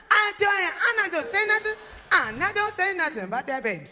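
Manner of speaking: put-on high, loud little boy swearing he'll behave; high loud kid protesting; insistent child voice. Timmy insisting to mama.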